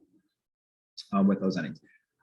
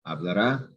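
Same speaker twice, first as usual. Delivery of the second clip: "Hablará" rising to a stressed final "rá"; stress on last syllable. Shown as a demonstration. Teacher shows the future form, "Hablará" stressed on final "rá". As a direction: land hard on the end of "Hablará". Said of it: "that's future".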